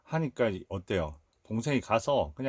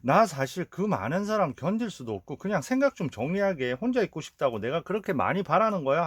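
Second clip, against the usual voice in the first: retold outburst; 7 semitones higher than usual. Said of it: "갑자기 승질을 냅니다".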